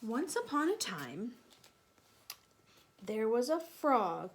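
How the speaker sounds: put-on lively read-aloud voice for children; storybook narration. A narrator's reading. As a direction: tell it like a bedtime story. Reads Beatrix Potter story aloud.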